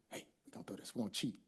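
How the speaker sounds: very soft, secretive whisper; conspiratorial whisper. Acted. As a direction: let the patient in on a secret. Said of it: "I'll whisper to him".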